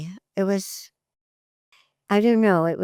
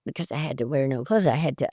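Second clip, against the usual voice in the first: ordinary talk, nothing marked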